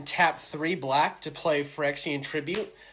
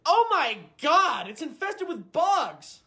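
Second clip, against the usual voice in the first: high, slow horrified cry; shocked disgust; 9 semitones higher, 3 dB louder, slower than usual. Acted. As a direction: recoil in horror. Clerk discovers cockroaches.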